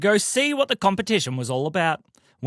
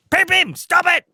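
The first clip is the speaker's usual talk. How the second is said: shouted stop; 13 semitones higher, 4 dB louder than usual. A narrator's reading. Teddy tells Pim Pim off.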